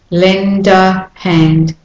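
"Lend a hand." very slowly; slow demo. Shown as a demonstration. Teacher slowing a phrase to show linking. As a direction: draw out each link. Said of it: "notice that as I go".

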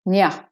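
"Nya" short and weak; de-stressed syllable. Shown as a demonstration. Teacher breaking the word up, unstressed "Nya". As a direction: let "Nya" fall to a light schwa. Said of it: "de-stressed".

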